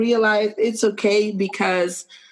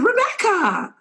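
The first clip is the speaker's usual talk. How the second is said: excited greeting; 12 semitones higher than usual. Retold or imitated. Star greets her by name.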